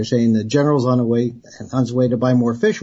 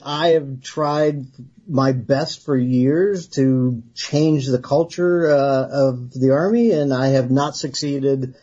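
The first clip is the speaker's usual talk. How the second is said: slow, weighed-out retirement words; slow, resigned farewell statement; slow and earnest; slower than usual. Retold or imitated. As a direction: measure each word like a final statement.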